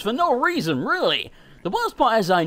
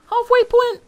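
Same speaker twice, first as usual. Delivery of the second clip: incredulous; 5 dB louder than usual. Real, not acted. Doubting a claimed halfway point.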